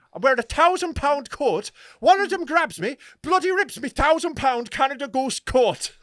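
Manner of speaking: high, loud Irish outrage; hammed-up Irish indignation; mock furious traveller. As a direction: rant in a put-on Irish traveller voice. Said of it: "like, furious".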